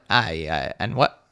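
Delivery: low, offhand reply; dismissive brush-off. Retold or imitated. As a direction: shrug off the warning, dropping the voice. Acted brush-off of friend's warning.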